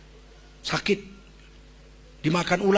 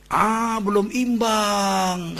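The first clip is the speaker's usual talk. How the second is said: slow, patient reply; 3 semitones higher, much slower than usual. Retold or imitated. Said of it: "kata Nabi Ayub".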